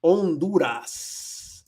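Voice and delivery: Honduras stretched slowly, on-DOO-ras, no H; Spanish pronunciation. Shown as a demonstration. It sounds cooler this way, long silent-H Honduras. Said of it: "Suena más chulo".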